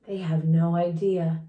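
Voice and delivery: ordinary delivery, nothing marked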